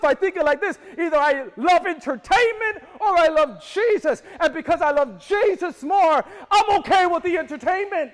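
loud, high, passionate preaching; shouted conviction. Real, not acted. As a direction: preach it out full force. Preacher at full force.

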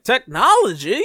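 mock southern bewilderment; southern accent. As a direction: imitate a southerner amazed by a gadget. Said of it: "putting on this southern accent".

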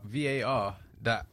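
usual speaking voice

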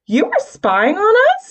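high, loud accusation; accusing. A narrator's reading. Stormy accusing Mark of spying, loud and high. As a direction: throw the question at him in anger. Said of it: "Stormy accused".

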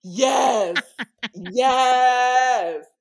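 loud, high drawn-out yell; excited cheering. Real, not acted. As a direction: shout your delighted approval. Cheering the suggested dream title.

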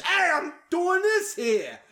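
slow, high-pitched New York holler, imitating a coworker; mocking New York accent. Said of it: "and he's just".

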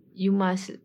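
usual speaking voice

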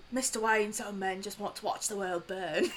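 a quiet, low attempt at a Michael Caine accent, clearly strained; an amateur's hushed Michael Caine accent; Michael Caine impression. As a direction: murmur the butler's line in a shaky Michael Caine accent. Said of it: "I can't do a Michael Caine accent".

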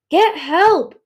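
loud, high cry for help; urgent yell. A narrator's reading. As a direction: yell it out in alarm. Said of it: "Peril yelled".

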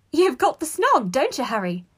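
high, loud, giggly taunt; excited, high-pitched gossip voice; gleeful teasing. A narrator's reading. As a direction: tease with delighted glee. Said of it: "She joined in the laughter".

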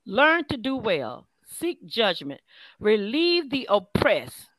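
slow, deliberate Bible reading; scripture reading. A narrator's reading. Minister reads Isaiah's commands to listeners, slow scripture reading. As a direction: read each command slowly, letting it land.

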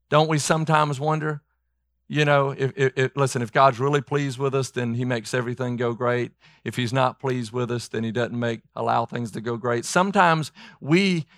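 ordinary delivery

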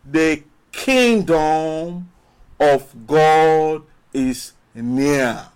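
drawn out, every word slow and weighty; slow solemn quote. Acted. Preacher slowly quoting Jesus to the congregation, solemn and drawn out. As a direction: lay the words out slowly like scripture.

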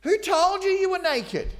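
high, loud anguished question; God's cry. Acted. God calling to Adam, high and loud. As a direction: call it out hurt and high. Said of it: "And God says".

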